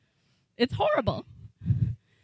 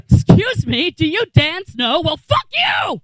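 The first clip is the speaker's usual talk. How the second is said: mock hostile outburst; 4 semitones higher, 3 dB louder, slower than usual. Acting out the song's attitude.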